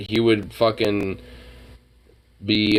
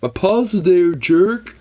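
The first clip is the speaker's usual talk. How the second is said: high, louder annoyed dog voice; indignant dog; 10 semitones higher, 4 dB louder, slower than usual. Retold or imitated. Dog yelling at owner about his paws, high loud. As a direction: voice the dog snapping at you. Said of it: "he'll just be like".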